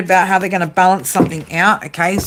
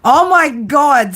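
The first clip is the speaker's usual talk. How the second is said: usual speaking voice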